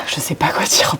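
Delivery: breathy whisper to herself. Real, not acted. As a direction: whisper it under your breath. Stuck mid-pitch, mouth on fire.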